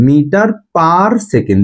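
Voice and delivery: "Metre পার second" read out slowly, each word apart; slow, careful teacher demo; slow unit reading. Shown as a demonstration. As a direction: model how the unit is read aloud. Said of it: "সেকেন্ডটাকে পরে উচ্চারণ করবা".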